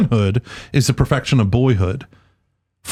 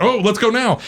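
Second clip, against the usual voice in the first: much higher, hurried doctor; urgent doctor; 10 semitones higher than usual. Retold or imitated. Host voicing the doctor rushing to deliver. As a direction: snap into action. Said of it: "And he's like".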